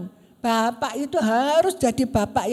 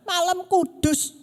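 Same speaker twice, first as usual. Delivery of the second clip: "malam kudus" said higher and slowly, indignant; angry outburst; 6 semitones higher, 3 dB louder than usual. Retold or imitated. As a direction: spit out "malam kudus" as an imitated complaint. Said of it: "tiba-tiba dia marah-marah".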